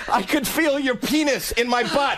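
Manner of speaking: high, slow, shouted child impression; imitated little daughter. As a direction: call it out innocently as a mock small girl. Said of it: "She goes".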